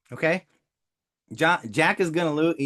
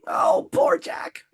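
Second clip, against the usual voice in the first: very high, drawn-out mock wail; hammed-up tearful squeal of pity; Titanic parody; much slower than usual. Coach mourning poor Jack, mock-tearful Titanic parody. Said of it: "I couldn't resist. Sorry, I, Titanic".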